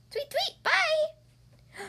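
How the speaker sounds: very high tweeting goodbye; cartoon bird voice. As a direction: chirp a bright farewell. Birds fly away.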